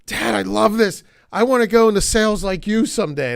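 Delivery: high and loud, overflowing with joy; thrilled child. Retold or imitated. Daughter running down the driveway to Dad after a sale, thrilled and high-pitched. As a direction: gush like a kid who just made a sale. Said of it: "so excited, beaming".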